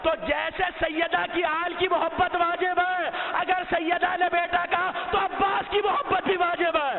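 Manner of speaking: full-force majlis oratory; preaching at full force. Acted. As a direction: proclaim it to the whole gathering. Fervent majlis oration.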